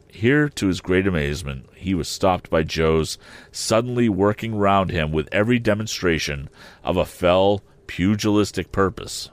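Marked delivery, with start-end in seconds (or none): none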